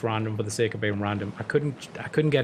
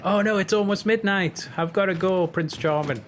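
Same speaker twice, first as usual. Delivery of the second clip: higher-pitched Cinderella in a fret; mock Cinderella; 6 semitones higher than usual. Cinderella telling Prince Charming she must leave at midnight, mock fretting. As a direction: play a fretting Cinderella, voice lifted.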